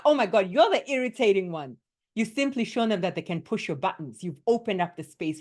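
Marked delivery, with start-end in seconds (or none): 0.0-1.8 louder, higher retort in mock anger; yelling back. Shown as a demonstration. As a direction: throw the insult right back. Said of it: "You could yell back".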